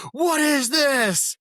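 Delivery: high, loud and drawn-out scolding; mock outraged demand. Instructors holding up the sleeping lieutenant, imitated outraged yell. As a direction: imitate an instructor bellowing in disbelief.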